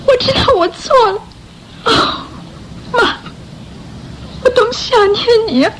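high, quick words through tears; tearful confession. Acted. Orphan girl crying for her mother, tearful. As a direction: break down longing for mother.

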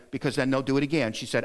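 usual speaking voice

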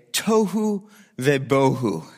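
"Tohu vebohu" said slowly, TOH-hoo veh-BOH-hoo; Hebrew pronunciation. Shown as a demonstration. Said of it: "in Hebrew you say".

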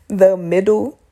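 slow laugh through the words; embarrassed chuckle while speaking. Real, not acted. Laughing at herself mid-story.

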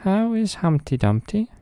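high, squeaky little mouse voice; tiny eager mice asking. Acted.

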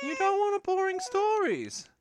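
put-on very high, loud baby talk. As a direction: squeak the question playfully. Asking the baby playfully.

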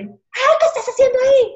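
high, loud startled yell; friend yelling. Retold or imitated. Friend catching them in the bathroom, high yell. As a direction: shout it like a friend catching you. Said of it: "una amiga gritó el nombre".

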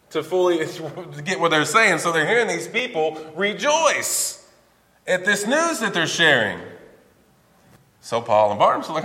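normal talking voice

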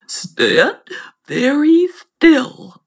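high, slow frog voice; warning frog. Acted. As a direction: hold her still, drawn out. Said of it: "Tinua looked at her and said".

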